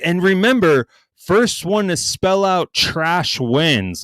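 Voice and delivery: high, slow mock announcement; imitating the seller springing a surprise rule; slow host rule. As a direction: drag out the rule like a game show host. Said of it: "And then he's like".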